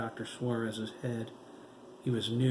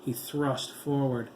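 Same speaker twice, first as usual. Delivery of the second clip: plain reading voice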